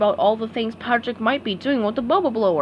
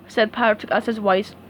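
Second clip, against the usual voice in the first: plain reading voice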